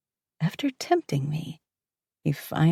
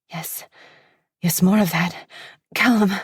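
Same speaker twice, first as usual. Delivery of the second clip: louder, rising cries; cry of pleasure; 5 dB louder than usual. Acted. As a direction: cry out his name, building. Heroine crying out in pleasure.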